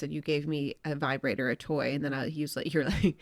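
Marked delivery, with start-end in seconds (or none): none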